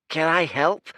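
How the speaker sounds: high, loud urchin voice; a grinning kid sizing up strangers; cheeky street kid. Acted. Street urchin teasing the lost fighters, high and cheeky.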